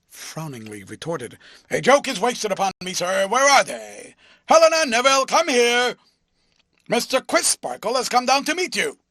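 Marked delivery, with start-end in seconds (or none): 1.7-9.0 high, loud, fast blustering call; loud summons. A narrator's reading. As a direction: bellow for the twins across the yard. Honeythunder calls the twins.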